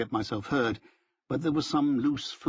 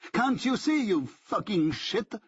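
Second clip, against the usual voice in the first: shrill high scream, half laughing; 9 semitones higher, slower than usual. A narrator's reading. Benjamin screams at Rick in hatred, nearly laughing. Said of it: "he screams".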